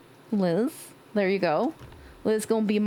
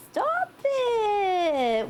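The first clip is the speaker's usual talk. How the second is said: high squeal; 10 semitones higher than usual. Acted. Second take, squealed.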